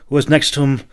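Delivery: usual speaking voice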